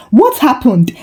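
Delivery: ordinary delivery, nothing marked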